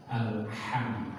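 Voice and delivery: "alham" with a clean throat h; correct alham. Shown as a demonstration. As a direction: say "alham" with a clear throat h, softly. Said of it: "Tapi yang betul adalah".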